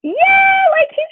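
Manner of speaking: a sudden high, loud yelp of agreement; excited yeah. Real, not acted. Reacting to a chat comment with a loud, high yelp. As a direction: burst out with it.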